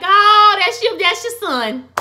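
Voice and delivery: high, loud squeal of delight; excited gush. Real, not acted. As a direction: squeal it over the sweet moment. Host gushes at hug scene.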